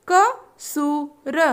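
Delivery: कसूर stretched very slowly; slow drawn-out word. Shown as a demonstration. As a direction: draw out कसूर syllable by syllable. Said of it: "तीन वर्ण वाले शब्द".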